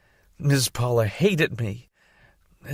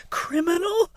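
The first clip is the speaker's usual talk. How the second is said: stunned disbelief. Acted. Boy stunned by accusation.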